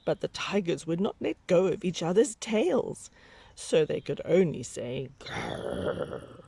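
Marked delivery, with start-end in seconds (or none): 5.2-6.4 low growl. Acted. Tigers growl.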